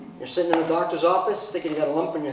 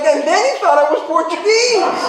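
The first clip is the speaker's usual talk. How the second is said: laughing retelling; 9 semitones higher, 5 dB louder than usual. Retold or imitated. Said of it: "I'm like".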